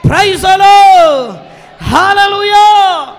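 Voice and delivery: full-throated preacher shout; shouted praise. Real, not acted. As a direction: stretch out the hallelujah loudly.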